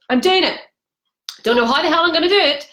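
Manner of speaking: loud, determined self-talk; emphatic resolve. Retold or imitated. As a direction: declare it forcefully, pitch jumping up. Repeats resolve emphatically, acting it out.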